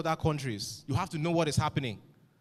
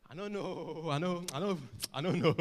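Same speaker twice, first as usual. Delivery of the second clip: imitating a too-cool shrug of not knowing; mock swag; 3 semitones higher than usual. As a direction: toss it off like ignorance is cool. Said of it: "made not knowing something feel like swag".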